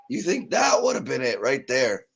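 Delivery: ordinary talk, nothing marked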